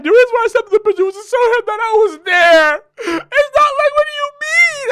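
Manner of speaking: high, crying girlfriend imitation; mock crying. Imitating girlfriend crying about the picture, high and tearful. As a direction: sob it out, high and wailing. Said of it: "That she's crying".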